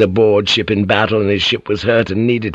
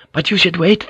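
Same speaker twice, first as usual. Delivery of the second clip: pleading maid; 8 semitones higher than usual. Acted.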